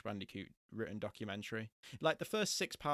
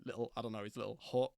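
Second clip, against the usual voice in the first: ordinary talk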